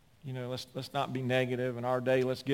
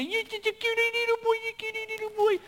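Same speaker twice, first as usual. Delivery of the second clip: much higher cooing put-on voice; mock bus driver. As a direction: mimic a doting driver pinching a cheek. Mimics smoking bus driver.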